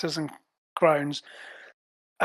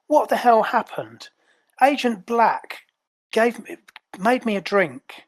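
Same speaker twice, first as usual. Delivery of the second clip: higher-pitched, upset agent demanding answers; aggrieved and confused, pitched up; indignant Azure; 5 semitones higher than usual. Acted. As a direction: play a bewildered agent, voice raised in pitch.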